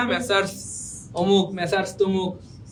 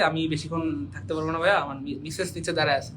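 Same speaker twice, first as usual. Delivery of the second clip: quieter, mock apologetic husband excuse; mock hurried husband; 5 semitones lower, 5 dB quieter than usual. Teacher imitates a husband whose wife waits below, hushed. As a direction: softly fake an excuse to leave early. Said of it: "অনেকে কয় না যে আমার Mrs".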